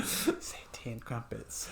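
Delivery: unvoiced whispered request; slow hushed aside. Real, not acted. Cohost whispering a request to the host.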